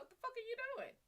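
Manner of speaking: high shrieking disbelief; shocked. Retold or imitated. To her boyfriend dumping creamer in her coffee, high shocked shriek. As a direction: shriek it like you just saw him ruin your coffee.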